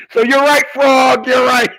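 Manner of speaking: loud and high, cackling on the edge of a laugh; high laughing yell of agreement; excited agreement. Real, not acted. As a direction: shout it out, barely holding in laughter. Cackling agreement with crude comment.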